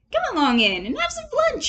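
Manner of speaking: smooth things over with a cheery offer; diplomatic Toad. A narrator's reading. Toad inviting the others in to win them over, diplomatic. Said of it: "he said diplomatically".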